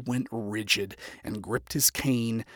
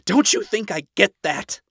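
loud, high, anguished comeback; shot back; 8 semitones higher, 8 dB louder than usual. A narrator's reading. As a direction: fire it back, upset and self-blaming. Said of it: "shot back with a self-loathing look".

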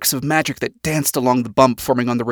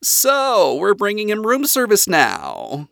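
disgruntled father, pitched up and drawn into a complaint; grumbling; 8 semitones higher, slower than usual. A narrator's reading. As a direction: grumble in indignant disapproval. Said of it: "Lucifer grumbled".

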